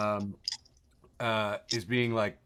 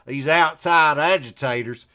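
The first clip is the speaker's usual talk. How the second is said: Southern accent; 7 dB louder than usual. Shown as a demonstration. Host mocking the phrase in a Southern accent. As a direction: say "These outside agitators" like a Southern sheriff. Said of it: "Say it again with me with a Southern accent".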